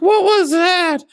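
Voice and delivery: very high, loud, shocked squeak; shocked squeal. Retold or imitated. Paramedic squealing after the shock. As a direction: squeal it like a startled man. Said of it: "He's like".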